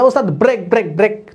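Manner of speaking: high call of a bus helper, telling the driver to brake; lightly voiced bus helper; bus helper shouting to driver. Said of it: "ও যা".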